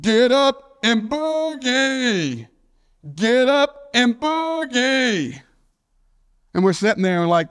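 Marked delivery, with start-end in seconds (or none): 0.0-5.4 high, drawn-out call with a falling tail; long, stretched catchphrase yell; imitated distant holler. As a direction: imitate a friend hollering from outside. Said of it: "the next thing we hear is".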